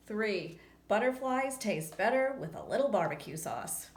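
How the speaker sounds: usual speaking voice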